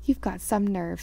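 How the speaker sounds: plain reading voice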